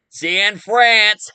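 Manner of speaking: high, loud mocking taunt from the villain; gloating villain. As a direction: throw it out like a cartoon baddie escaping. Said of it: "you hear Gang Green laughing, saying".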